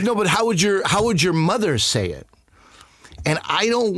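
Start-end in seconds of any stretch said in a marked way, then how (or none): 0.0-2.3 eager boss. Retold or imitated. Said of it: "then they're like".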